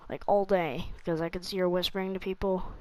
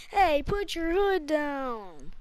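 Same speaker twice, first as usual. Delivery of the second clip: teacher voice; 8 semitones higher, 5 dB louder, much slower than usual. Retold or imitated.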